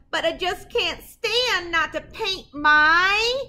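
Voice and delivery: suspense build. Acted. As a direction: build it up, then land the word.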